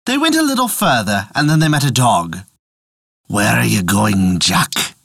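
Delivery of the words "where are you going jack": low gruff dog voice. Acted. Dog asks Jack.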